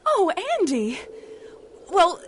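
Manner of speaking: bursting with joy and mock indignation; delighted outburst. [name] overjoyed at love confession.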